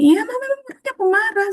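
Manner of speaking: mock high confession. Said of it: "Kita bilang ke anak".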